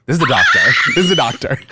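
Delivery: shouting through laughter; loud, high, laughing emphasis; loud laughing exclaim. Real, not acted. Stressing it was the doctor.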